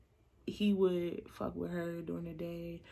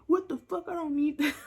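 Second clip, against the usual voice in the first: mock indignant guy; 8 semitones higher, 6 dB louder than usual.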